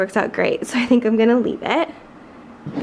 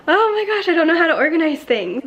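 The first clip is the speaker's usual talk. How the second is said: mock overwhelmed reaction; 7 semitones higher than usual. Voices her own overwhelmed reaction.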